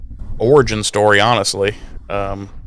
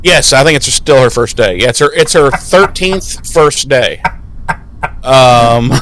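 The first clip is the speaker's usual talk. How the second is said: ordinary talk, nothing marked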